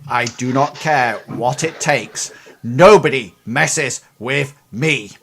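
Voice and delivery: loud threatening order; angry boss. Acted. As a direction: lean in and threaten. Irate boss voiced.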